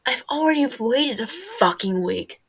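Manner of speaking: loud, exasperated complaint; frustrated outburst. Acted. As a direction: snap it out, fed up. Tommy frustrated, complaining loudly.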